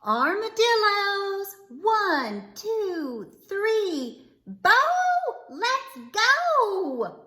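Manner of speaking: put-on a mother rounding up her kids outdoors; sing-song roll call; mama calling her boys. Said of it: "called to her boys".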